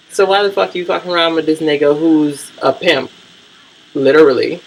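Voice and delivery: ordinary delivery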